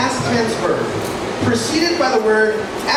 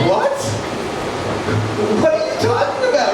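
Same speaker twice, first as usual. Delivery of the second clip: baffled listener; 11 semitones higher than usual. Retold or imitated.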